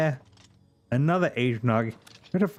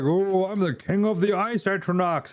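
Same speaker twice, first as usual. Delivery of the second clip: higher, haughty boasting voice of a puffed-up ice king; pompous enemy boast; 7 semitones higher than usual. Retold or imitated. Mocking the enemy's boast, in a haughty higher put-on voice. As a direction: lord it over the hero in a snooty put-on voice. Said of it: "He's all like".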